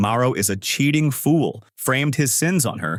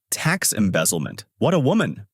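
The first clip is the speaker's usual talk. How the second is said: usual speaking voice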